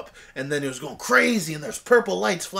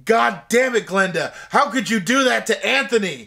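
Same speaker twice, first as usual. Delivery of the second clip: louder, higher hammed-up rant; mock angry outburst; 6 semitones higher, 6 dB louder than usual. Characters scolding Glenda to camera, mock loud anger. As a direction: snap at Glenda like a movie climax. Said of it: "they said".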